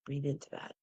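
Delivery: soft, hushed meditation voice with long pauses; guided meditation. Acted. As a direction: guide them gently into calm.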